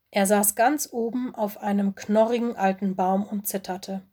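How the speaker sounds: ordinary delivery, nothing marked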